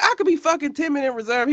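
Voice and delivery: retold high-pitched, rapid protest; indignant protest. Sister retelling her protest to her brother, high indignant outburst. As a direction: protest fast and high, mock-outraged. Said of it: "you sound real timid and reserved".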